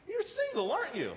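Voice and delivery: put-on squeaky-high girl at the checkout; cashier girl voice.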